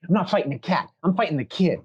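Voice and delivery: high, scoffing elf voice; indignant elf. Acted. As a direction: refuse with a haughty scoff. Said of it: "she's like".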